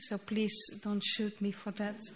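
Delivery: ordinary delivery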